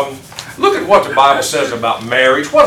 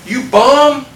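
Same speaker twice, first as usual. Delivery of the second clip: mock God scolding; 11 semitones higher, 6 dB louder than usual. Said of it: "what did God say to Elijah".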